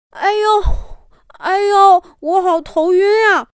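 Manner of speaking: high, whiny, faint with hunger; hungry dizzy koala. Acted. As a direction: whimper that you're starving. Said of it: "被小考拉考考给打断了". (饿得头晕的小考拉撒娇)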